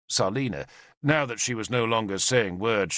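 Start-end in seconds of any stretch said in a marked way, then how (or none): none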